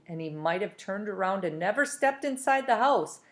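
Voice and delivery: plain reading voice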